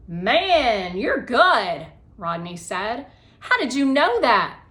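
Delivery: loud, high amazement; amazed kid. Acted. Rodney amazed by Rose's guess, loud amazement. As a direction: marvel at her answer.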